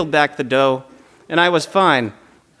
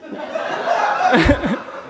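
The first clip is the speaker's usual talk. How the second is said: breathy laugh; 6 semitones higher than usual. Real, not acted. Laughs at own joke.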